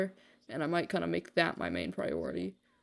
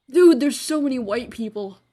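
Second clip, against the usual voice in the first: high, loud startled outburst; sudden alarmed exclamation; startled exclaim; 5 semitones higher, 9 dB louder than usual. Real, not acted. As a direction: blurt it out, caught off guard. Startled by crowd.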